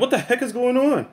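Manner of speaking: high, startled question; a sudden high what-is-this; surprised wife. Retold or imitated. Wife coming home to a surprise, high and startled.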